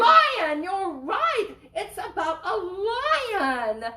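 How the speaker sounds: high, loud delighted Lion; excited praise. Real, not acted. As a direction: cheer the right answer. Excited praise of kids' answer.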